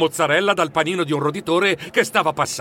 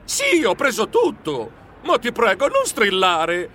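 higher, pleading protest, a touch slow; 4 semitones higher than usual. Acted. Geronimo protests to his shouting sister, pleading. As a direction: beg her to stop shrieking. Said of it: "Io protestai".

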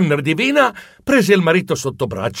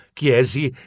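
usual speaking voice

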